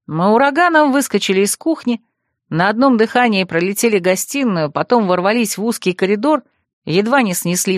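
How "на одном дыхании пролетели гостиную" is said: ordinary delivery, nothing marked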